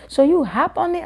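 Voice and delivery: high, amazed question; amazed classmate. Retold or imitated. Classmate amazed by the elephant story, high amazed. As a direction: ask in wide-eyed wonder. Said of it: "classmate asks".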